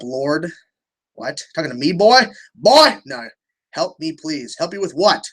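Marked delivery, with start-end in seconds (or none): 2.5-3.0 loud high bark of a call; shouting. Real, not acted. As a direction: yell it across the room. Yelling at someone off-stream.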